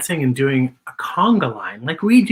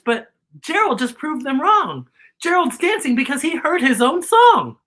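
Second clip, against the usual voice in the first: higher, bright, thrilled reveal; excited; 6 semitones higher than usual. Real, not acted. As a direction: share the big moment with delight.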